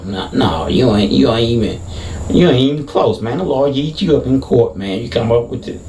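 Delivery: ordinary talk, nothing marked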